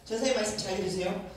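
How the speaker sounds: ordinary delivery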